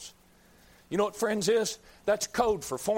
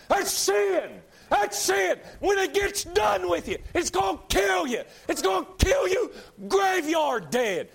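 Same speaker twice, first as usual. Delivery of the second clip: high, loud preacher's yell; shouted fire-and-brimstone climax; hellfire shout; 11 semitones higher, 3 dB louder, slower than usual. Acted. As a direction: bellow the warning at the congregation.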